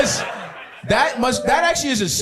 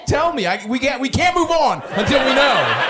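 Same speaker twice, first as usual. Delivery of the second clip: high, insistent, laughing near-shout; pleading demand; 7 semitones higher than usual. Real, not acted. Host pressing the guest for details, high insistent laugh.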